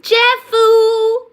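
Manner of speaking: loud high call; shouting. Real, not acted. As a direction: call the name out. Shouting the brand name.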